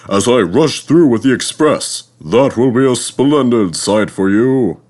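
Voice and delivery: boasting. A narrator's reading. Gordon boasting to little Edward, loud and proud. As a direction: puff yourself up and show off. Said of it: "he boasted".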